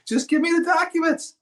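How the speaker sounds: high, pleading exasperation; exasperated plea. Retold or imitated. Attorney begging silent sellers, high and exasperated. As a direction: beg for the documents, fed up.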